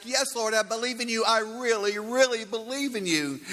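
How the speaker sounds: high, fast, desperate calling up; pleading shout. Retold or imitated. Hanging man pleads up to the Lord, high fast shout. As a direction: beg the heavens, pitched high and fast. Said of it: "man shouts back".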